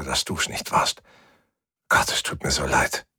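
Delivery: whispered apology, soft and sincere; whispering. Acted. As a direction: breathe the words to yourself. Apologizing softly to the ship.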